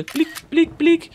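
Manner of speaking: high squeaky blick blick like a shutter; playful high shutter sound; squeaky camera click noise. Pretend.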